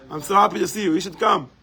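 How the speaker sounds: ordinary talk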